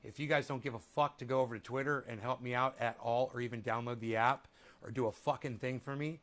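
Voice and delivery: usual speaking voice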